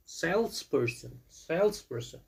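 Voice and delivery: Salesperson said slowly, with SALES stressed. Shown as a demonstration. Teacher drilling word stress for the class, slow. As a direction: lean on the first syllable of Salesperson.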